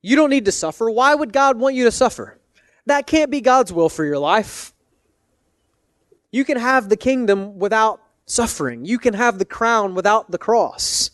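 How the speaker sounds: high, pleading tempter voice. Retold or imitated. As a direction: coax him like a tempter. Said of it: "Peter was essentially saying".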